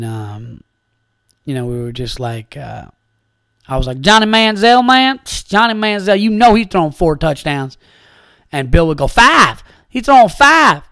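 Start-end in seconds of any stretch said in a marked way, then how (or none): 4.0-7.8 mock Texan football fan; Texan accent. Goofing on a Texas road trip with Bill, hammed-up Texan accent. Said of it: "Bill and I were doing accents". 9.1-10.8 much higher, louder mock Texan; Texan accent. Retelling Bill's Texan bit in the car, mock Texan accent. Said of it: "Bill and I were doing accents".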